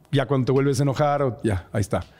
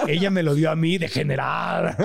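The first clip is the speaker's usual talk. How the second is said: riendo mientras bromea; laughing; 8 semitones higher than usual. Real, not acted. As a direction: habla entre risas, divertido. Laughing after joke about first kiss. (entre carcajadas)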